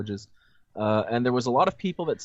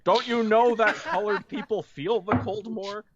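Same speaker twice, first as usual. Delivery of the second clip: laughing; 9 semitones higher than usual. Acted. Mock racist quip with laugh.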